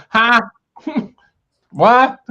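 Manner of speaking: high, loud mock-confused outburst; uncomprehending president. Joking about baffled listeners like the president, loud mock confusion. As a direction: play up not understanding a word. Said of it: "Like the president".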